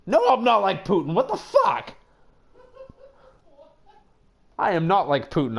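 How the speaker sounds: high, loud indignant denial; mock outrage. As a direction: protest loudly, hamming up the offense. Indignant denial of being Putin.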